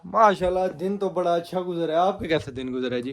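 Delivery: loud, high, overdone cheer; hammed-up loud small talk; acting cheerful. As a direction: talk over them loudly as if no one else is there. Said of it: "acting کرتے رہنی ہے".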